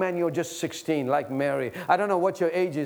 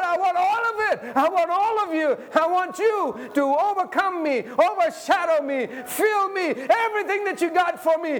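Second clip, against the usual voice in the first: ecstatic prayer; 10 semitones higher than usual. Acted. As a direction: pour it out in rising fervour. Crescendo of fervent prayer.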